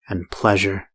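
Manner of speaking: ordinary delivery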